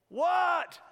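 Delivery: high, loud, irritated "What?"; annoyed shout. Acted. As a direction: bark it out like a woken neighbour. Neighbour shouts at second knock.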